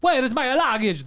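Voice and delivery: mock Italian traveller asking for luggage; Italian sing-song. Shown as a demonstration. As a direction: sing the question Italian-style.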